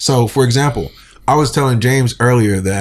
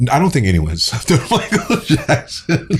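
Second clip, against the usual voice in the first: higher voice breaking into laughter; laughing; 5 semitones higher than usual. Real, not acted. Laughing off anyone having slept on [name]. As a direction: say it through a laugh.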